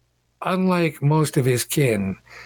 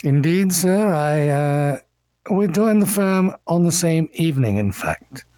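lightly voiced refined attorney, a little higher; cultured elven gentleman; 6 semitones higher than usual. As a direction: answer with courteous, cultured formality. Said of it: "in very cultured common says".

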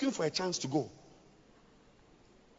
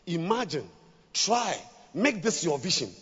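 ordinary talk, nothing marked

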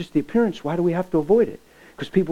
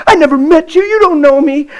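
indignant shout; 8 semitones higher, 9 dB louder than usual. Retold or imitated. Girl protests against stranger.

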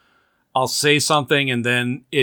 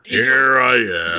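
gloomy Eeyore voice; much slower than usual. Pretend. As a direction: play Eeyore resigned to his fate. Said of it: "I think Eeyore's right around that corner".

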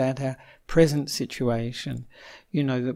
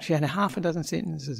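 ordinary delivery

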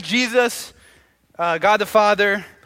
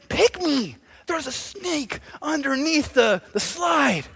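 put-on slow, higher-pitched child voice; little Johnny; 7 semitones higher, much slower than usual. Johnny tells mom about a snake, slow high child voice. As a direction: tell it earnestly like a small boy. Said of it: "little Johnny comes up to my mom".

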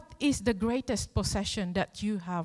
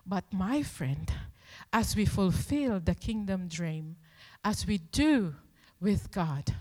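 normal talking voice

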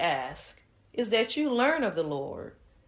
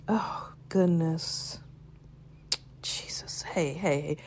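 shocked whisper; 3 semitones lower, 5 dB quieter than usual. Acted. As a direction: whisper it as bad news sinks in. Shocked by Tiffany's bad news.

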